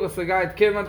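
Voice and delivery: normal talking voice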